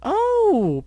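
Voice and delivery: very high, loud joyful gasp; joyful exclaim. Acted. Pinkie seeing her friend for real, joyful. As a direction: burst out in delight. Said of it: "Pink exclaimed joyfully".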